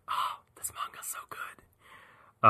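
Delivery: slow, whispered gushing; awed whisper. Real, not acted. Fan gushing over a manga, hushed and slow. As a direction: whisper it as if overcome.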